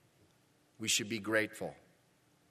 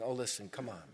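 ordinary delivery

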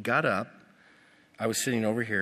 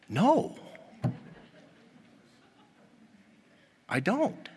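emphatic no; 11 semitones higher, slower than usual. Retold or imitated. Pastor flatly refuses.

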